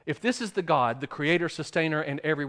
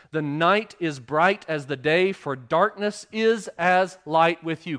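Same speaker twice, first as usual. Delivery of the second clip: psalm read aloud, louder and measured; solemn reading voice; scripture reading; 5 dB louder than usual. Acted. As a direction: proclaim the psalm to the congregation.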